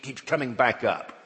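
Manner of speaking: ordinary talk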